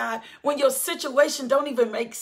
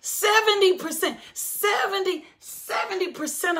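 high, raised cry of the figure; high preaching emphasis; exultant repeat; 6 semitones higher than usual. Real, not acted. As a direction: lift the number up in praise.

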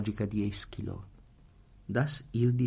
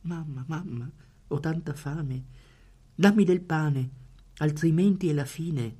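put-on desperate child; 6 semitones higher than usual. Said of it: "gridò il bambino disperato".

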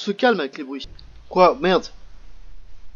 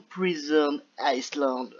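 put-on slow, showy English accent; 3 dB quieter, much slower than usual. Gamer introduces the game with his magnificent English accent, English accent. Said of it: "avec mon magnifique accent anglais".

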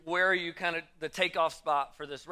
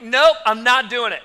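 1 loud panicked refusal; 4 semitones higher, 10 dB louder than usual. Retold or imitated. Refusing to go down rapid.

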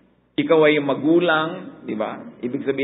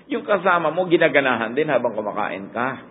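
ordinary talk

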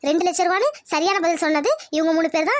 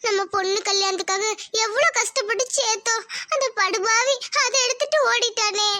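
grieving mother; 3 semitones higher than usual. Acted. Wife to husband over lost savings, tearful. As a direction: mourn the stolen wedding money.

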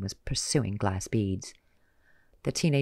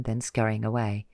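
plain reading voice

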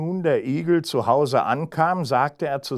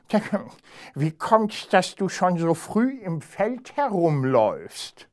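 high, nasal-free arrogant hare voice pitched up; haughty hare; 7 semitones higher than usual. Acted. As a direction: look down your nose at the hedgehog, higher. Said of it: "Der Hase aber war ein hochmütiger Geselle".

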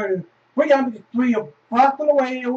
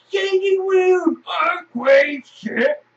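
drawn-out excited shout, higher; shouted retelling; 8 semitones higher, 4 dB louder, much slower than usual. Retold or imitated. Telling viewers about the earthquake, slow excited shout. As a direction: stretch it out like the room is still shaking.

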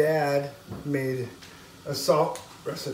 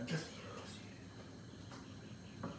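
much softer whispered scolding; hushed mom whisper; 21 dB quieter, faster than usual. Retold or imitated. Mom hushing her child at a friend's dinner, whisper. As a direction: hiss it so the hosts won't hear.

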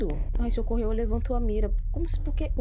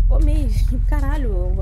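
slow, louder laughing exclamation; laughing loud outburst; 4 semitones higher, 9 dB louder, slower than usual. Real, not acted. Streamer shocked by a pig, laughing loudly.

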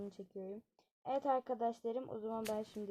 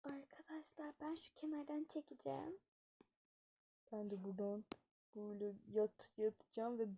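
hushed prank whisper; 9 dB quieter than usual. Acted. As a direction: whisper to the viewers, softer, so the sleeper won't wake. (şakadan önce fısıltı)

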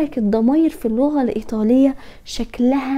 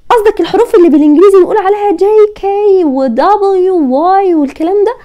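student's objection; 6 semitones higher, 4 dB louder than usual. Pretend. Teacher plays a student asking about English letters, mock objection. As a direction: pipe up higher, like a pupil querying the lesson.

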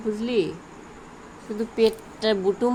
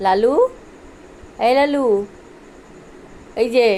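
yelling across the room; 2 semitones higher, 6 dB louder than usual. Real, not acted. Calling the pet from afar.

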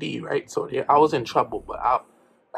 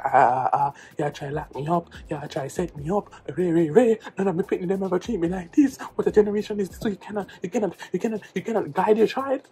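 heavy Jamaican patois, pitch climbing as he goes; Jamaican dad ranting; 4 semitones higher than usual. Retold or imitated. Dad protesting at the school, rising Jamaican rant. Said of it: "and then he was like".

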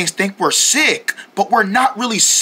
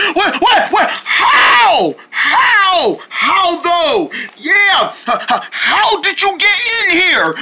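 shocked high outrage; 8 semitones higher, much slower than usual. Acted. Deerlings find the bugs inside, shocked and shouting. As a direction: stammer out shocked disbelief.

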